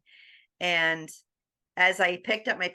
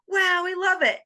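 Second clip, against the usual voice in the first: higher, louder delighted praise; excited daughter; 12 semitones higher, 6 dB louder than usual. Retold or imitated. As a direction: burst out with delight.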